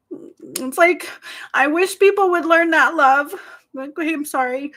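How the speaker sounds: real tearful plea; close to crying. Real, not acted. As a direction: voice rising as tears well up. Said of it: "and I could cry".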